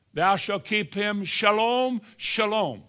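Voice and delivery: shalom said shah-LOME, slow and high; Hebrew shalom. Shown as a demonstration. Preacher giving the congregation the Hebrew word, slow and lifted. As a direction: give shalom its Hebrew sound, twice. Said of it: "It's twice for emphasis".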